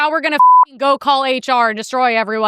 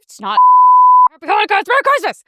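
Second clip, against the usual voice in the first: mock correction; 12 semitones higher, 7 dB louder, faster than usual. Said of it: "I wanted to just be like".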